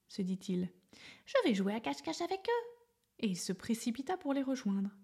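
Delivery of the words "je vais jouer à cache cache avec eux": high and quick, eager; a small elephant rushing to play; little elephant voice. A narrator's reading. Little elephant joining the monkeys, high quick eager voice.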